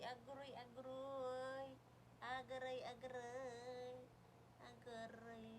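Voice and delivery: drawn-out ouch. Pretend. Baby pulling hair, drawn ouch.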